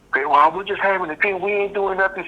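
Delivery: mock high-pitched protest from the ex-wife; ex-wife getting loud. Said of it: "She starts getting loud".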